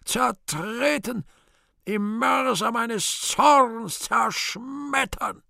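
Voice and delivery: high, slow, strained bellow; roaring with strain. Acted. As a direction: tear it out with all your force. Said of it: "brüllt mit furchtbarer Anstrengung".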